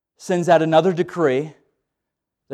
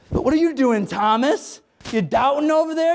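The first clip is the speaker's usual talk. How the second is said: teasing Peter; 7 semitones higher than usual. Acted.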